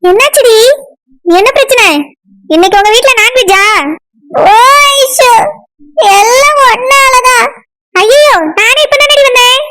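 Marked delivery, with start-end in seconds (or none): 4.4-7.4 slow, drawn-out crying wail; tearful. Acted. Durga blames her visiting friend, tearful wail. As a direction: whine it through tears, stretching the words. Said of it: "இப்படி உட்கார்ந்து அழுதுட்டு இருக்க".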